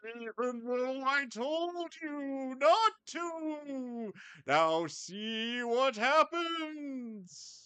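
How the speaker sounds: slow, high, loud ghost yell; angry ghost yell. Acted. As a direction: drag out the threat, loud and high. Ghost scare in diary.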